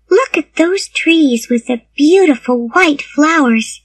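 put-on high-pitched little girl, full of delight; chatty little girl. A narrator's reading. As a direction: chatter admiringly in a high child's voice. Voices the talkative girl.

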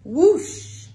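ordinary delivery

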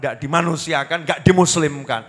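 ordinary delivery

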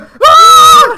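very high, very loud scream; excited scream. Real, not acted. Player has killed the hacker, excited scream. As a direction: scream at the sudden victory.

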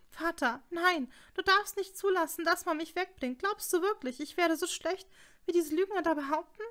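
high, loud, panicked cries like someone drowning; desperate plea. A narrator's reading. Daughter begging her father not to let them take her, high desperate cries. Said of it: "klang wie die von einer Ertrinkenden".

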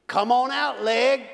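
put-on cowboy with a thick country accent barking an order. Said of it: "with his country accent".

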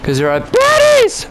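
very high put-on squeal; hammed-up falsetto jeer; mock taunt. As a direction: screech the taunt in a silly high voice.

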